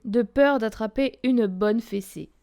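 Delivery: plain reading voice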